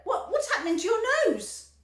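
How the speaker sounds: high, loud panicked question; alarmed. A narrator's reading. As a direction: panic at the sight. Mother alarmed.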